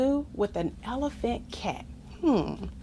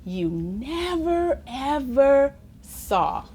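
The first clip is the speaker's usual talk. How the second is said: slow dramatic; 2 semitones higher, slower than usual. Acted. Slowed dramatic ending.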